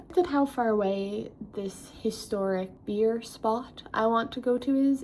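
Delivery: ordinary delivery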